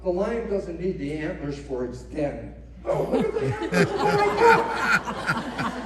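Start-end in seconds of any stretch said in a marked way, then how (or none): 2.8-5.7 airy, breathless mock amazement, much higher at the end, slowed; mock awestruck gasp. Speaker mocks a lion gawking at antlers, breathless awe. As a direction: gush over the antlers like a dazzled shopper.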